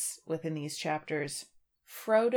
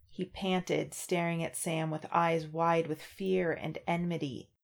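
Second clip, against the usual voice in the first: normal talking voice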